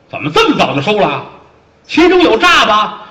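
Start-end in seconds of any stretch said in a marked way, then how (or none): 1.9-3.1 high, loud, slow doubting question; drawn-out suspicious demand; suspicious officer. Acted. Fat officer suspecting a trick, high and loud.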